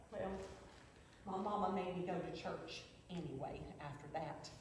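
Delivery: low, soft, drained of the anger; hushed, low recollection; subdued confession. Acted. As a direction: confess it quietly, voice dropped right down. Recalls lost faith after sister's death.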